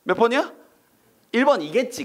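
high, bright feigned surprise; mock surprise. Teacher to class with mock surprise. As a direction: act amazed the answer appeared.

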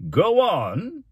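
pompous mayor's order, pitched high and loud; commanding. Acted. Mayor urging the reluctant student, commanding. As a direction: press the boy to go on, loud and high.

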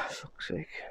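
ordinary delivery

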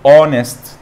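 اونست loud, opening on the vowel with silent h; demonstrated pronunciation. Shown as a demonstration. Teacher pronouncing with the class, loud اونست without the h. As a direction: sound out اونست loudly from its o. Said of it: "تعال ننطقها مع بعض".

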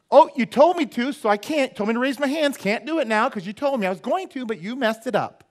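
pouty, high mock refusal; mocking stubborn churchgoer. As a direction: sulk like a contrary child. Mocking rebellious reply.

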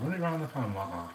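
fast and soft, run it round the pan said again quickly. Shown as a demonstration. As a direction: toss off the repeat quickly and quietly. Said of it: "what you wanna do".

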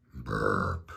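very low rumbled burp; soft, low imitated burp; voiced burp noise. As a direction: make a low rolling burp sound. Spelt story sound effect.

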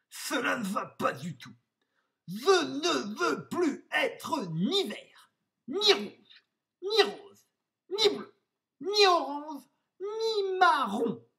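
high, loud, exasperated wolf outburst; put-on high voice, frantic tantrum; at wit's end. A narrator's reading. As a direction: hammer out each color, fed up. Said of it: "à bout de nerfs".

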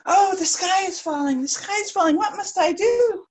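high, loud, flustered hen cry; panicking hen. Acted. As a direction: squawk it out in a fluster.

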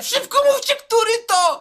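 very high, loud furious scream. Retold or imitated. Furious boy screaming at the class to name the culprit. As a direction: shriek the demand at full force. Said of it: "Te się drze".

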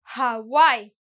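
Hawaii said with a clear J-like H at the start; H sounded. Shown as a demonstration. Teacher showing students a sounded H. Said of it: "as if in Spanish I would be listening to a J".

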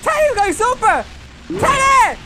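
high, hyped-up cries; excited calling out. Real, not acted. Gamer watches Teddy go super, excited calling out. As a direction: exclaim it up high in amazement.

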